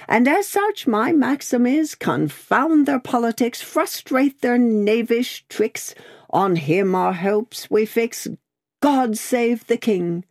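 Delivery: high, declamatory recital; Miss Pross, patriotic. A narrator's reading. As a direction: recite it like a proud anthem verse.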